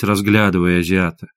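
usual speaking voice

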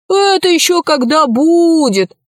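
lightly howled, high-pitched complaint; howling wolf. A narrator's reading. Wolf doubting the promise, a light howl. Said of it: "завыл волк".

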